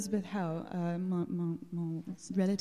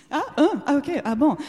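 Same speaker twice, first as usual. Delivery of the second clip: laughing; 6 semitones higher, 8 dB louder, faster than usual. Real, not acted. Audience says nobody visited Salem.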